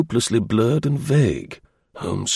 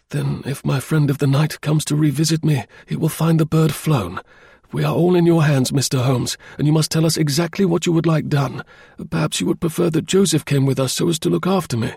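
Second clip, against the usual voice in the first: put-on higher-pitched, earnest young man; higher, trusting client deferring to the detective; eager client; 5 semitones higher than usual. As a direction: speak up keenly in a lifted voice. Narrator voices client Phelps.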